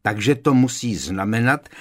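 loud, impatient reasoning; undisguised irritation. A narrator's reading. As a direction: spell it out testily to a dim ally. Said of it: "ani se nesnažil zakrýt podráždění".

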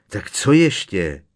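loud, drawn-out impatient question; impatient, slow and loud. A narrator's reading. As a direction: ask with exasperated impatience. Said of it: "zeptal se Harry netrpělivě".

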